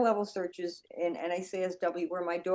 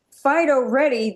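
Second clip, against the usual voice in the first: louder, higher "Fido, ready"; sing-song call of the dog's name; mock handler cue; 6 semitones higher, 7 dB louder than usual. Shown as a demonstration. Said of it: "Because when you say".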